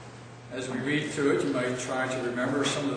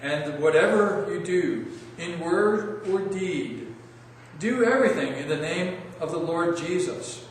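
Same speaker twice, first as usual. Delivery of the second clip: slow, raised, projected Bible reading; measured public reading, louder and higher, each phrase set apart; scripture reading; 5 semitones higher, 4 dB louder, slower than usual. Real, not acted. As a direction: read the passage aloud to a congregation, deliberate and emphatic. I'd like to once more read.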